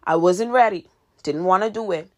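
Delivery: ordinary talk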